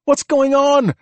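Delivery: high, loud demand over noise; yelling. A narrator's reading. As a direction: yell for news over the radio. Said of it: "she yelled".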